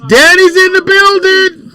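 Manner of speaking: high, drawn-out hype call; hype announcement. Real, not acted. Radio host hails the arriving guest, high hype announcement. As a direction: announce the guest with a big stretched shout-out.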